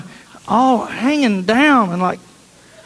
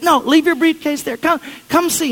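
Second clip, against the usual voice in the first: higher, insistent and excited; eager daughter; 8 semitones higher than usual. Retold or imitated. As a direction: urge him on, can't wait.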